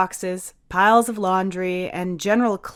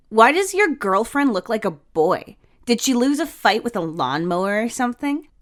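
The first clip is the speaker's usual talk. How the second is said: mocking jab; 5 semitones higher, 4 dB louder than usual. Tyler mocking Tia's hair.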